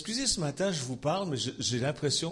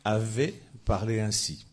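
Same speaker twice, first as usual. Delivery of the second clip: scripture reading; 4 semitones lower, 5 dB louder than usual. Acted. Reads scripture aloud.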